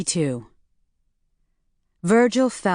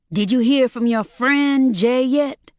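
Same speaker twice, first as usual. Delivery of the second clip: friend pushed hard, louder and higher; stress on friend; 7 semitones higher, 4 dB louder than usual. A narrator's reading. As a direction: lean on the word friend with suspicion. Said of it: "putting a lot of emphasis on the word friend".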